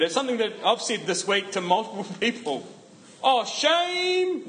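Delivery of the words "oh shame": "Oh, shame" pitched way up high and stretched long; exaggerated wrong take. Shown as a demonstration. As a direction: overdo "Oh, shame" with a high swoop on shame. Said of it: "Not like that".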